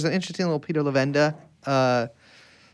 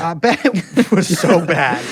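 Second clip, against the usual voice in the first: laughing; 4 dB louder, slower than usual. Real, not acted. Joking about Marilyn's weed.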